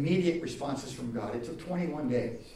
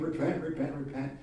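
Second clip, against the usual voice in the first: ordinary talk, nothing marked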